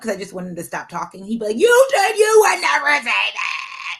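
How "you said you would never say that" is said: high, slow, loud accusing cry; hurt kid's shout. Retold or imitated. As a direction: throw her own promise back at her like a wounded child. Son voiced accusing mom.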